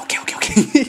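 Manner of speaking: pleased whisper. Retold or imitated. Answering the secret compliment.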